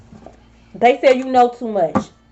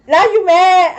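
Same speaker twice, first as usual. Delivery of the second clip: high, louder mocking jab; loud, high teasing question; taunting; 7 semitones higher, 5 dB louder, slower than usual. Real, not acted. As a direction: needle them with a sing-song taunt. Taunting the offended viewers.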